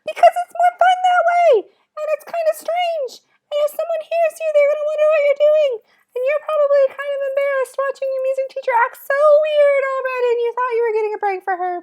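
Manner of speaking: loud, silly, squeaky voice way up high; super high-pitched voice. Shown as a demonstration. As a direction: squeak it out at the very top of your range. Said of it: "with a super high-pitched voice".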